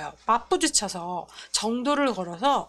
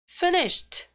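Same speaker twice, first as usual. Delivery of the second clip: high, falling "finished" as one beat; stressed single word; 8 semitones higher than usual. Shown as a demonstration. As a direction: say "finished" alone, pitched up then dropping. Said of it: "이렇게 읽는 거랑". ("finished"를 높게 시작해 한 박자로)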